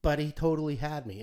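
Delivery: ordinary talk, nothing marked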